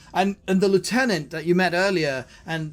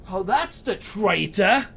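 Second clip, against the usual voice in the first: slow, raised-pitch cry of recognition; dawning, higher-pitched denunciation; accusing cry; 5 semitones higher, 3 dB louder, slower than usual. Acted. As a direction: call out the accusation, drawn out. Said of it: "he screams to his soldiers".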